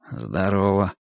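low, subdued grunt of hello; understated gruff greeting. A narrator's reading. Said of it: "буркнул Нуржан".

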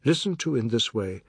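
ordinary delivery, nothing marked

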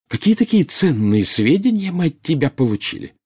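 high, derisive questioning; mock the very idea of valuable information; scornful challenge. A narrator's reading. Bellatrix demanding what Snape ever gave them, scornful. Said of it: "фыркнула Белатриса".

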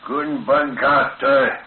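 very slow and deliberate. Acted. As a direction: draw every syllable out. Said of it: "zegt dan langzaam".

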